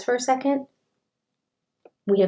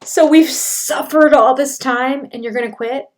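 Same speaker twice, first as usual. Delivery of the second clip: louder, rising, disbelieving echo; incredulous repeat; 5 semitones higher, 8 dB louder than usual. Retold or imitated. Host echoing Cody's quit line in loud disbelief. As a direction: repeat it like you can't believe he said it. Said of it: "I gotta pause".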